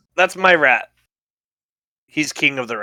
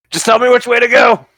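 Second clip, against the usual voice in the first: loud, raised call on the run; urgent shout; 10 semitones higher, 7 dB louder than usual. Acted. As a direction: shout for directions mid-dash. Shouting while running with boy aloft.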